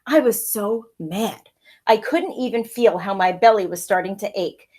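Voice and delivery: lively read-aloud storytelling voice; story narration. A narrator's reading. Teacher reading a picture book to her pupils, lively storytelling.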